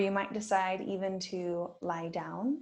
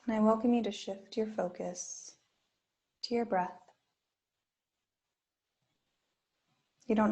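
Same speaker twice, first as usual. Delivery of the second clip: guided meditation. Acted. Yoga teacher guiding students through a body scan, gently. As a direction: ease listeners into stillness with long pauses.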